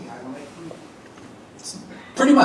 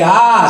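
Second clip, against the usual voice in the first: loud stretched ah with tongue out; 7 semitones higher, 5 dB louder than usual. Shown as a demonstration. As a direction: hold a loud ah, tongue stuck out. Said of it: "stick your tongue out, stretch it out".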